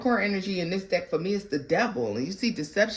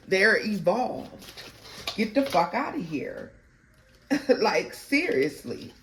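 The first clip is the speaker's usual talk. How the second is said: ordinary talk, nothing marked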